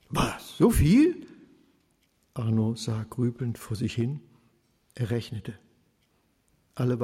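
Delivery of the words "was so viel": hoch, laut und gedehnt ausgerufen; entsetzt überrascht. A narrator's reading. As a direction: fassungslos über den Preis staunen. Arno entsetzt über den Preis. (Arno hört den hohen Sanierungspreis, fassungslos gedehnt)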